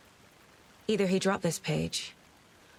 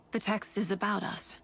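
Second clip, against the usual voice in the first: usual speaking voice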